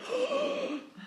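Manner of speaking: sharp high-pitched intake of breath; amazed gasp. Retold or imitated. Host acts out onlookers awed by passion with a gasp. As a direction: gasp as if dazzled.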